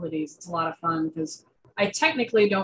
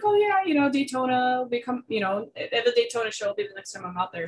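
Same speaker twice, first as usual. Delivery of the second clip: eager reply; 5 semitones higher than usual. Retold or imitated. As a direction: answer the invite with excitement. Retelling her own eager reply.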